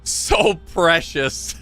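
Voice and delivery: slow cooing, then laughter; adoring coo then giggle. Real, not acted. Cooing at princess.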